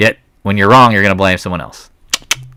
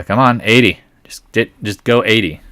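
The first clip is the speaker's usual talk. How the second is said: usual speaking voice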